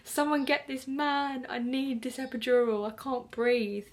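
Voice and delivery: high, drawn-out plea, easing down; begging. Retold or imitated. Labouring mother who can't breathe wants an epidural, retold begging. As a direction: beg for help, stretching the words. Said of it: "I was, like, begging".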